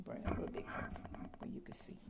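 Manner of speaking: soft unvoiced whisper, quick. Real, not acted. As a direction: whisper it quickly as you show the candle. Whispering while showing the candle.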